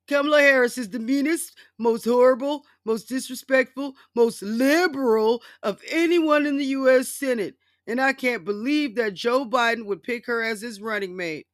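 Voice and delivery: mock Trump reading. Reading Trump's email aloud to viewers in a loud, high mock rant. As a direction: belt it out like an outraged Trump. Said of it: "So I'm gonna read the latest Trump email".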